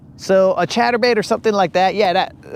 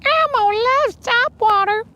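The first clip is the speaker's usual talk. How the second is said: high squeaky puppet voice; Elmo voice. Pretend. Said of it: "We gotta have Elmo".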